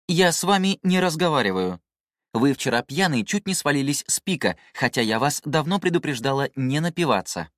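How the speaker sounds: high-pitched, huffy scolding; offended female system. A narrator's reading. System scolds its user for last night's drinking, offended.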